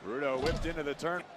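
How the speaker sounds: lively play-by-play call; wrestling commentator. Acted. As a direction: call the match as it happens.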